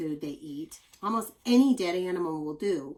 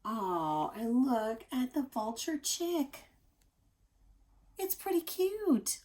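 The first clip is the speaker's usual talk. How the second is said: slow, much higher cute voice; cooing at chick; 5 semitones higher, slower than usual. Real, not acted. Teacher showing children a cute vulture chick. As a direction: gush over the fluffy chick.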